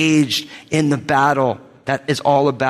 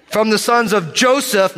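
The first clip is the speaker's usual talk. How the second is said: measured, emphatic roll-call of names; bold scripture reading; 5 semitones higher than usual. Real, not acted. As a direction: proclaim each name boldly, stressing its last syllable. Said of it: "gonna read these names with boldness".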